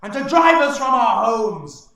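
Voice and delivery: rally the tribe with a roaring speech; triumphant orc warlord bellowing to his warriors; orc chieftain declaring. Acted. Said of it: "the orc said".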